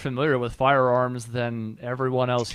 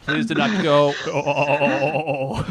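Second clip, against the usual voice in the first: slow motion robot; 3 semitones higher, much slower than usual. Retold or imitated. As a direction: drag it out like a lagging stream. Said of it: "You sounded like a slow motion robot underwater".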